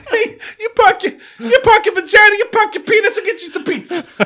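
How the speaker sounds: very high, loud laughing Boston rant; Boston accent. Pretend. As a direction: shriek it as a hammed-up Boston local. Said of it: "You have to drop R's".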